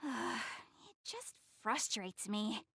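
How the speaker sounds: slow, soft, weary and exasperated; a heavy frustrated mumble; frustrated sigh. Acted. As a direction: let it drag out with a sigh. Sighs, frustrated about revealing cosplay.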